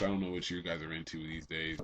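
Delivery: normal talking voice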